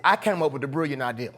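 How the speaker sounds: normal talking voice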